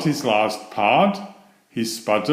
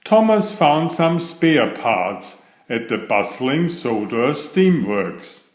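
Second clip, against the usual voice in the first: ordinary delivery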